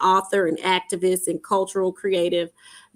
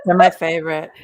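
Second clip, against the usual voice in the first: laughing; 5 dB louder, faster than usual. Real, not acted. Guests joking about big neuro words.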